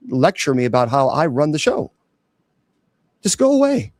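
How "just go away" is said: high, dismissive just go away; dismissive shoo. Acted. Bishop brushing off a nagging commenter with a high dismissive shoo. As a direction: wave her off with scorn. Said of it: "dismissing Melissa".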